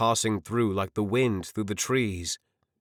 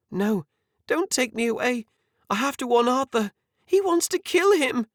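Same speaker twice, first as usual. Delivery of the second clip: high, rising panic, louder to the end; desperate plea; 3 dB louder than usual. A narrator's reading. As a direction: beg them, frantic to warn Arthur. Una begs soldiers, warning of murder.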